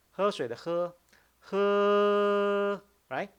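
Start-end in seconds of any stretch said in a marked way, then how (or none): 1.5-2.8 "he" held long, high and loud; loud, steady, drawn-out high "he"; prolonged first tone. Shown as a demonstration. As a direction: stretch "he" on one level high pitch. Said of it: "When you pronounce the first tone".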